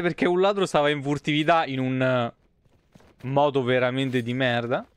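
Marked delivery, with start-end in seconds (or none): none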